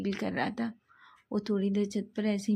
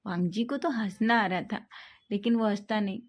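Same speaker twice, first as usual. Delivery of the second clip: plain reading voice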